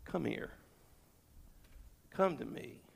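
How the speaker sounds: very hushed, low beckoning; tender near-murmur, unhurried; gentle invitation. Acted. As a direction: softly invite a weary person closer. Gentle invitation quoted from Jesus.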